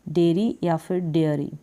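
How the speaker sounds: डेयरी said first as de-ree, then as de-air-ee; teacher showing both forms of डेयरी; dairy two ways. Shown as a demonstration. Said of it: "डेयरी इस तरीके से".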